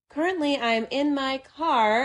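lightly voiced news reporter; news anchor voice. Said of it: "the best news voice".

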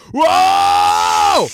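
long, high, loud whoop; frat-boy whoop. Retold or imitated. Naked wrestler showing off, long high whoop. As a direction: whoop like a hyped-up party boy. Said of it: "and he goes".

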